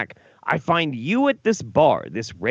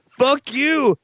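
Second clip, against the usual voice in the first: mock drunk outburst; 13 semitones higher, 4 dB louder than usual. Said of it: "and you're like".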